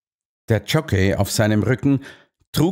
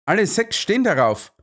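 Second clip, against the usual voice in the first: high, eager exclamation; excited calling out; 9 semitones higher than usual. A narrator's reading. Narrator calls out the board to the colonel, excited. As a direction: call it out in a raised pitch. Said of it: "rief ich".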